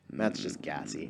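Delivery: slow, soft deadpan jab; low teasing aside. Real, not acted. Teasing friend about burp.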